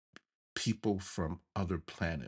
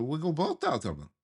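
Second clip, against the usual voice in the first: put-on little kid's voice; 10 semitones higher, 4 dB louder than usual. Narrator as a little boy.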